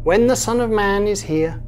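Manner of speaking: usual speaking voice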